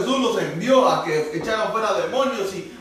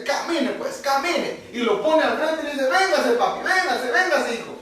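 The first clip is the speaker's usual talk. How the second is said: much higher, sing-song coaxing with a laugh; coaxing father; 8 semitones higher than usual. Retold or imitated. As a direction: coax a toddler to take a step. (padre animando al bebé a caminar, voz aguda y cariñosa)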